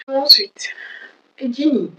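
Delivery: ordinary talk, nothing marked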